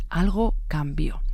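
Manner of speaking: slow, hushed whisper, building suspense; ominous whisper. Acted. Narrator to viewers, hinting at the killer's turn, slow ominous whisper. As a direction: breathe it out slowly like a dark secret.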